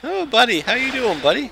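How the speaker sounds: high-pitched, playful hail to a buddy; mock buddy greeting. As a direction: put on a silly high voice to greet your pal. Mock Canadian greeting after buddy talk.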